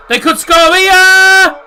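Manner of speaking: high, loud, euphoric yell as the ball goes in; excited goal shout. Real, not acted. Streamer shouting at a live goal, real excitement. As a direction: scream it like the goal is happening now. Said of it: "My voice nearly went there".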